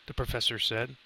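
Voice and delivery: ordinary delivery, nothing marked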